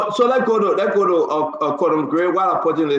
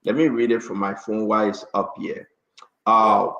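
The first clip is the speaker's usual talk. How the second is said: ordinary talk, nothing marked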